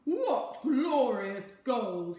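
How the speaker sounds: loud, slow, delighted king; cheering king. A narrator's reading. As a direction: boom out the joy at the gold. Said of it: "he cheered".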